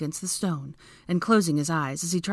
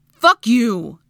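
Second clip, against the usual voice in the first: loud, raised-pitch outburst; angry yell; 6 semitones higher, 10 dB louder than usual. A narrator's reading. Draco turning forcefully in the crack, yelling back. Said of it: "he yelled back".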